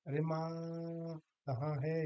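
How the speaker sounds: demonstrated call, long-drawn माँ; calling out to mother. Shown as a demonstration. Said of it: "माँ को ही आवाज़ लगा रहे हैं".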